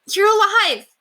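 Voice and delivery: high, loud delighted cry; overjoyed. A narrator's reading. Squire greets revived knight with a high, loud joyful cry. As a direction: burst out with disbelieving joy.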